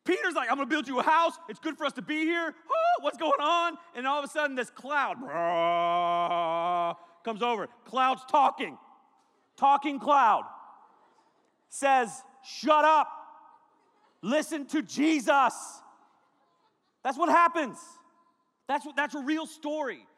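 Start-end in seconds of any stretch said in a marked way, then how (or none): 0.5-3.8 higher, louder rattled babble; high loud flustered chatter; frantic Peter. Acted. 12.4-15.8 louder, slow, stern command; loud stern slow rebuke; cloud scolding. Acted. Talking cloud scolds Peter.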